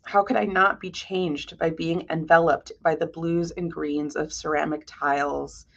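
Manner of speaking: ordinary talk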